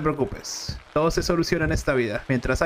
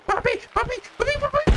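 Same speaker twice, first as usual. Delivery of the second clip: very high panicked cries for papi; panicked calling; faster than usual. Acted. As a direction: shriek for dad in panic.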